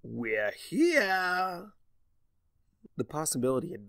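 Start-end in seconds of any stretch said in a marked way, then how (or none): 0.0-1.7 high excited announcement; bright, raised, showman's cry; cried out. Acted. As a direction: trickster proudly announcing arrival. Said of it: "Discord cried".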